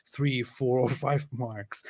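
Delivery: ordinary talk, nothing marked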